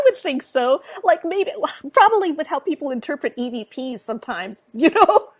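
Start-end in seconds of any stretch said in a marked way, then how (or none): none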